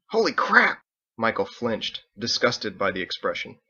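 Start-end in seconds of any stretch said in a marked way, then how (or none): 0.1-0.8 high, loud blurted outburst; excited exclamation. Acted. Ditch bursts out at the announcement, excited and loud. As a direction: shout it out in amazement.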